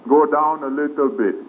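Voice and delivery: normal talking voice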